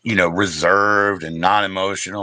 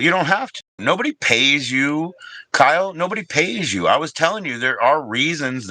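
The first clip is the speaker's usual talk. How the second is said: heated insistence; 7 semitones higher than usual. Real, not acted. Ranting at mod Kyle.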